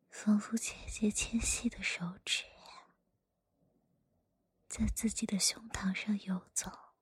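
hushed storyteller whisper; whispered story reading. Acted.

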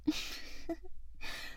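breathy high giggle; little mocking giggle; teasing giggle. Teasing roleplay laugh. (轻笑)

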